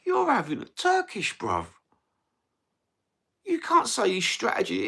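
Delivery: mock-outraged rebuttal to the critics; incredulous retort. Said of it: "I'm thinking to myself".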